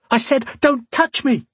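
high-pitched acted scream. A narrator's reading. Woman feigning an assault by him, screaming. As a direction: shriek it to alarm the room. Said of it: "she screamed".

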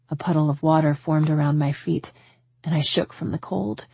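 ordinary delivery, nothing marked

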